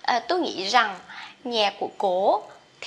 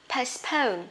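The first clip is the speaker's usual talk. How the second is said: usual speaking voice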